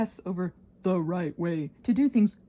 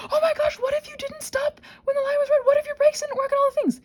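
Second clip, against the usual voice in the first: high, loud, panicked inner voice; mock panic, much higher and louder; panicky intrusive thought; 5 dB louder than usual. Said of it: "intrusive thoughts that come to my mind".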